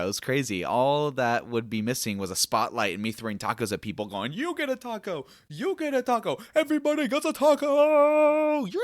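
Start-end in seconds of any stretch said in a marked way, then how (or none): 4.3-8.8 much higher, building to a loud hype yell; hammed-up talk-show host handing out prizes; mock Oprah, rising to a big cheer; Oprah giveaway shout.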